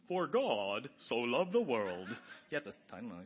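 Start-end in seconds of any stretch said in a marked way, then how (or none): 0.1-2.1 put-on slow, low, old-fashioned reading voice; mock King James voice. To the congregation, mimicking how people read King James, slow and solemn. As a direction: intone it slowly like an old Bible reading. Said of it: "sometimes people talk like that".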